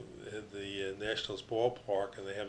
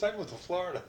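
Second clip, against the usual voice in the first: repeated quote; 9 semitones higher, faster than usual. Retold or imitated. [name] repeats, retold.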